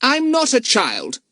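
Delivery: put-on slow, high offended boy; indignant. A narrator's reading. Boy objecting to the policeman, indignant. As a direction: protest with wounded pride. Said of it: "said the boy indignantly".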